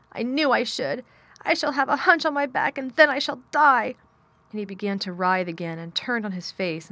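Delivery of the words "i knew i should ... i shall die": high, loud, tearful despair; choked wail. A narrator's reading. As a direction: wail it out, choked with self-pity. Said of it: "choked out Colin".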